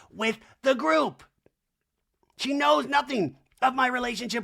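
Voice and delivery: high mock Kyle voice. Recapping Kyle's talking head in a high mocking voice. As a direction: play Kyle, offended.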